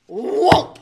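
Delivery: ordinary delivery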